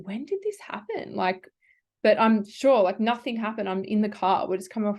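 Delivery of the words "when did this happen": high, soft puzzled question; quietly bewildered; high and hushed, baffled. Retold or imitated. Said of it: "I'm just thinking".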